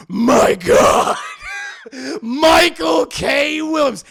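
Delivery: very loud, high, drawn-out mock scream; stunned, stretched-out shout; shocked outburst. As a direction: bellow the name in hammed-up disbelief. Man shocked by revelation.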